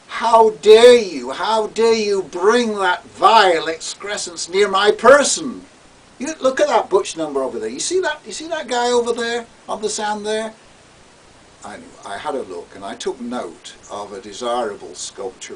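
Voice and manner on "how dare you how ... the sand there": high, loud, indignant outburst; outraged. Retold or imitated. Gary erupting at the narrator on the beach, outraged and loud. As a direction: hurl the fury of a scandalized man.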